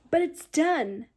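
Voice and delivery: loud happy cry of relief; excited shout. Real, not acted. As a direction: shout it out, thrilled. Finished masters.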